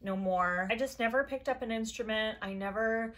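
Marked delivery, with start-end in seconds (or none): none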